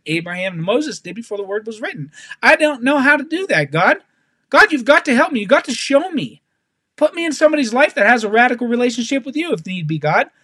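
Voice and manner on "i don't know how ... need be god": higher-pitched, urgent pleading prayer; impassioned appeal, voice lifted; crying out to God. Acted. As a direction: plead earnestly for God's help. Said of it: "You see how I'm crying out for God's help".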